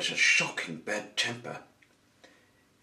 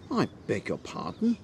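higher, politely affronted query; taken aback; 5 semitones higher than usual. A narrator's reading. Mister Happy is ordered out of the garden, taken aback. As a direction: question it in polite surprise.

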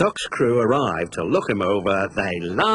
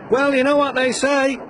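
laughing driver; 4 dB louder than usual. Acted. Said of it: "laughed his driver".

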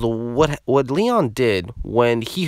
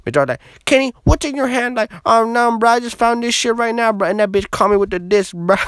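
very high, loud mock-panicked bro; hammed-up squealing bro retelling, pushed hard; imitating frantic friend; 13 semitones higher, 5 dB louder, faster than usual. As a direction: play a buddy freaking out, way up high. Friend's account continues, increasingly frantic.

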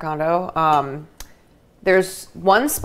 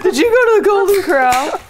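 high, laughing, loud and amused; laughing question; 13 semitones higher, 6 dB louder than usual. Real, not acted. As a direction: ask it through a burst of laughter. Teasing question while laughing.

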